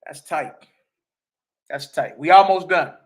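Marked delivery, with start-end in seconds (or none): 2.2-2.9 louder, punchy announcement; rallying the stream audience, loud; emphatic. Real, not acted. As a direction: call it out to the room. Repeats phrase, loud then soft.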